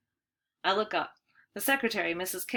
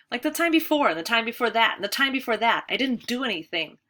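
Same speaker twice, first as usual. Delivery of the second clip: raised voice, pressing on; insistent protest; 4 semitones higher, 6 dB louder than usual. A narrator's reading. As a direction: keep pushing the protest louder. Boy protesting to headmaster.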